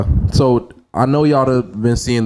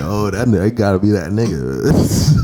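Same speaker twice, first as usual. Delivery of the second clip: low laughing mock voice; laughing impression; 5 semitones lower than usual. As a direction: chuckle through a mock voice. Imitating listeners recognizing name.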